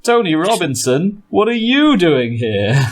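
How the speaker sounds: high-pitched put-on surprise; mock astonished greeting. Game master hails an unexpected celebrity, high mock astonishment. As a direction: pitch it up in playful disbelief.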